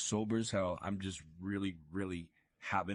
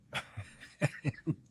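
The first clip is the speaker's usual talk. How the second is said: breathy laugh; 6 semitones higher than usual. Real, not acted. Laughing at his own story.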